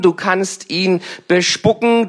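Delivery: normal talking voice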